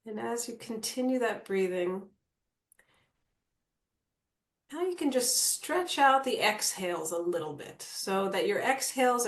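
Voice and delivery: calm meditation guide with long pauses; guided meditation. Acted. Guide settles the group into natural breathing, calm meditation voice. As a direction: ease them into stillness, leaving space between phrases.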